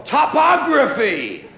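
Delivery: high, loud, drawn-out scream; long comic holler; screamed word. Shown as a demonstration. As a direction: scream it out slowly, as if daring anyone to object. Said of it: "screaming topography".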